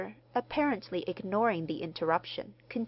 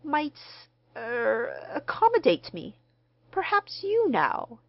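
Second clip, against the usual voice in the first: uncertain request; 7 semitones higher, 4 dB louder than usual. A narrator's reading. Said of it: "he glanced uncertainly".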